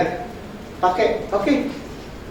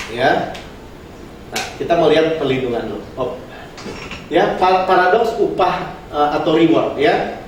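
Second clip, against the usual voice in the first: usual speaking voice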